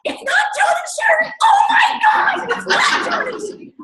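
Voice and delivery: high laughing shout of disbelief; excited shriek. Retold or imitated. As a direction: shriek it, laughing, as the names aren't called. Team hears ranking announced.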